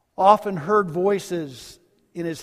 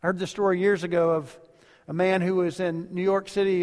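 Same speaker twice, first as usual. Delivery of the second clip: ordinary delivery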